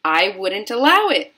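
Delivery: loud, emphatic outburst; forceful, indignant denial; cried objection. A narrator's reading. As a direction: cry out in refusal. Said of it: "cried Mister Wonka".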